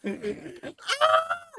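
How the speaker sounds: very high, loud scream breaking into a laugh; shriek, half laughing; shrill scream. Retold or imitated. Imitating the screaming girl, a very high shriek with a laugh. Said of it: "Y empezó a gritar".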